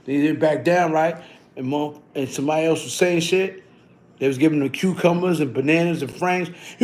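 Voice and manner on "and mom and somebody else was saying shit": ordinary talk, nothing marked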